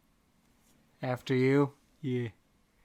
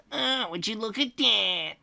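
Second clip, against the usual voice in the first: slow, higher nerdy voice; nerd voice; 6 semitones higher, slower than usual. Acted. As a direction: marvel slowly like a nerd. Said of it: "Dean Thomas uttered fluently".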